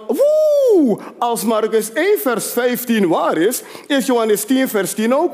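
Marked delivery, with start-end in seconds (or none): 0.1-1.0 excited exclamation. Real, not acted. Excited exclamation mid-sermon.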